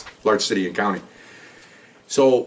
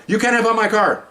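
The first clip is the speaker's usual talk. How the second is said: much higher, mock indignant protest; imitating protesting woman; 12 semitones higher, 3 dB louder than usual. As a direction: squeal her protest up high.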